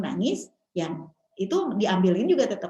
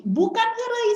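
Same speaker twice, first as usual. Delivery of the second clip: high, louder retold rebuke; indignant rebuttal; 14 semitones higher, 5 dB louder than usual. Correcting a mother who calls it joking, indignant. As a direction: cut the joke down in a raised voice.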